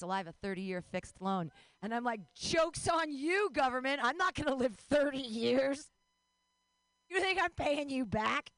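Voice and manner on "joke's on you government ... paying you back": loud, higher, drawn-out mock defiance; hammed-up gleeful jeering; gloating taunt. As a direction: crow at the government in triumph. Taunting the government over loan.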